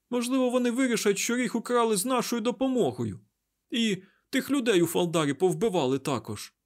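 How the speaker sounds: high, agitated worry; panicked rambling. A narrator's reading. Mat fears blame for the stolen horn, panicked.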